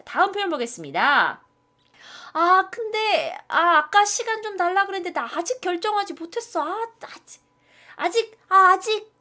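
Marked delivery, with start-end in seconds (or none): 2.3-9.1 much higher, hammed-up indecisive diner; hesitant customer. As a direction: ham up not knowing yet. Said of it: "일부러 좀 넣어놨어요". (메뉴 못 고른 손님처럼 과장되게 망설이며)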